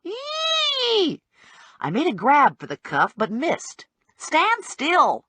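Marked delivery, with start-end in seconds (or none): 0.0-1.2 very high, held squeal; shriek. A narrator's reading. Little man reacting to the handcuff, high-pitched shriek. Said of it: "still shrieking". 4.2-5.2 raised-pitch command; firm order. A narrator's reading. Bounty hunter to the fleeing man, firm high-pitched order. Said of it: "I ordered".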